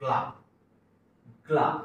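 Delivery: "ग्ला" with g, l and aa fused into one syllable; blended gla. Shown as a demonstration. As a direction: say "ग्ला" as a single joined sound, not g-la. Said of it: "एकत्रित स्वर झाला पाहिजे तिघांचा".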